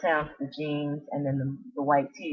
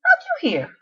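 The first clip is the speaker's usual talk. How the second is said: upbeat question; 10 dB louder than usual. Retold or imitated. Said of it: "She said".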